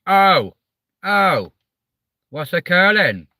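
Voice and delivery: loud, high, drawn-out Welsh "oh"; Welsh impression. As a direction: call it out in a put-on Welsh accent. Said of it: "What would be really Welsh if I start going".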